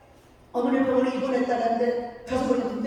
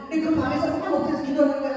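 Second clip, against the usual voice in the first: normal talking voice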